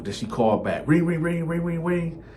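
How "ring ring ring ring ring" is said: ordinary talk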